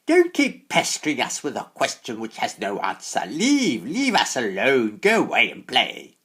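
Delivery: loud, high furious witch; angry hags. A narrator's reading. Old hags angrily sending the boy away to play. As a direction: drive him off with a crone's fury. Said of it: "even more angrily than before".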